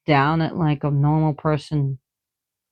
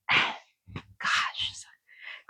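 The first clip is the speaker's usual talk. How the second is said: whisper; 10 dB quieter, much slower than usual. Real, not acted. Sighing, whispering to herself.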